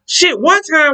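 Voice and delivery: ordinary talk, nothing marked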